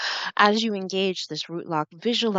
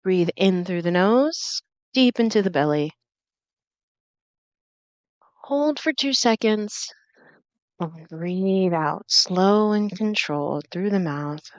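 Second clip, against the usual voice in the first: guided breathing; 3 semitones higher than usual. Acted. Leads slow breathing exercise.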